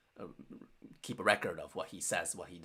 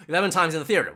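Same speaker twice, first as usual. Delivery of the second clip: louder, higher, quick astonishment; amazed repeat; 8 semitones higher, 13 dB louder, much faster than usual. Real, not acted. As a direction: blurt it back with a laugh, impressed. Astonished repeat of what he just read.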